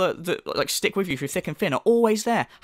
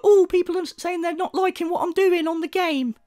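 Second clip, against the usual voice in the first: much higher, petulant whine; whiny streamer mock; 11 semitones higher than usual. Mocking the streamer's complaint about chat, much higher and whiny. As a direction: whine it like a sulking child. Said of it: "and you're like".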